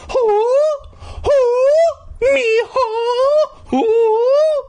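high, loud owl-like hooting of who; mock spooky hooting. Reader hamming up the mystery visitor with loud hooting. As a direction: hoot each who like a ghostly owl.

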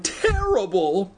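high, drawn-out wail of dismay; cried out. A narrator's reading. Girl horrified by the rampaging hamster, drawn-out high cry. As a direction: stretch the word slowly, pitched high. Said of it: "she cried".

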